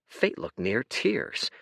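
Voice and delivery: normal talking voice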